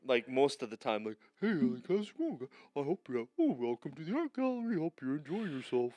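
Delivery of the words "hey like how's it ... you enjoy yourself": lightly put-on goofy greeter; a touch of a dopey welcome; gallery greeter voice. Host mocks how he greets at gallery, lightly dopey. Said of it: "I sound like a moron".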